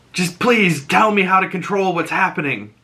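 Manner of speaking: acted-out begging, cutting in; urgent pleading. Retold desperate plea, cutting mother off.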